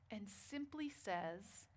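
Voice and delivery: ordinary talk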